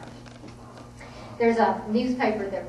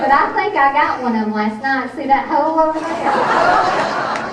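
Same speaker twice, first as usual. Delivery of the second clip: loud, raised-pitch imitation of a mountain grandmother; triumphant grandmother; 5 semitones higher, 12 dB louder than usual. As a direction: belt out the punchline as the feisty old woman. Grandmother's boast as story's punchline.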